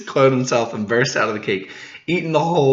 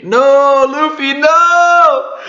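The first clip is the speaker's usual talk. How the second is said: very high, loud, slow drawn-out scream; anguished scream; 10 dB louder, much slower than usual. Acted. Onlooker screaming at Luffy in horror, drawn-out scream. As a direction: scream it as if watching disaster.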